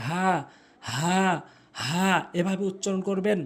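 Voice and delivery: "হা" light, from the start of the throat; correct pronunciation. Shown as a demonstration. Teacher models the Arabic letter, light "হা".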